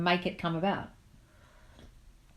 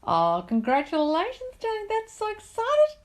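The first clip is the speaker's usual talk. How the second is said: high, stretched-out squeal of delight; thrilled, high-pitched cheering; excited congratulations; 14 semitones higher, 4 dB louder, slower than usual. Real, not acted. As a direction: gush slowly, climbing very high. Congratulating viewer on new home.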